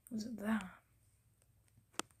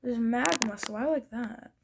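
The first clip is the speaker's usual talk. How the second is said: ordinary talk, nothing marked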